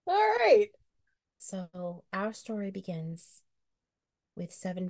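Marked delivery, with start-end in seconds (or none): 0.1-0.7 excited. Real, not acted. Eager start of turn.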